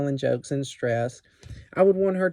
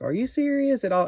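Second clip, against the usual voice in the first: higher incredulous "Are you serious at all?"; disbelief; 10 semitones higher than usual. Shown as a demonstration. Teacher explaining what "Girl" means, incredulous. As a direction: ask it like you can't believe it. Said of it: "that means".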